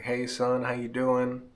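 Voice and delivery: a hint of a caring mom calling him son; imitating his mom. Voicing late mom greeting him.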